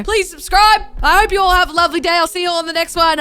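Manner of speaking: high, loud bubbly outro; fast excited goodbye; energetic sign-off. Real, not acted. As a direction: bounce out of the video cheerfully.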